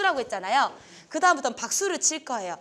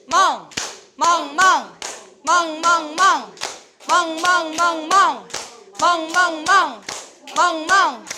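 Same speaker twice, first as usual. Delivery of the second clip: loud, higher rhythmic barking; shouted barks; 5 semitones higher, 7 dB louder, much slower than usual. Shown as a demonstration. Teacher shouting barks with the class, loud and high. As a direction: bark out each clap with energy. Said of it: "멍으로 외치시면 돼요".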